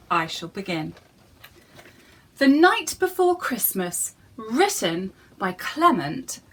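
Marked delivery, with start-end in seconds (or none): none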